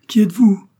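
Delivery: loud, high-pitched astonished cry; overwhelmed outburst of a question; exclaimed question. A narrator's reading. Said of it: "s'écria-t-il en joignant les mains".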